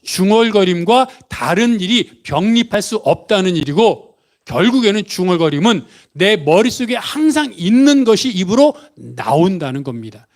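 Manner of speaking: ordinary talk, nothing marked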